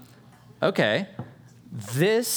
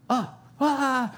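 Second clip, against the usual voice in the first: high panicked little cries; startled yelp; 14 semitones higher than usual. Retold or imitated. Peter realizing he's free, sharp high startled cries. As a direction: jolt in alarm, very high. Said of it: "And he goes".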